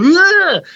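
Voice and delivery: loud, high mock groan of disgust; a big retching ugh at bitter beer. IPAs make him go.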